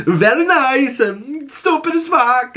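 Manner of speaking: goofy Borat bit, slow on the last words; laughing Borat caricature; Borat impression. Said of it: "I pictured Borat".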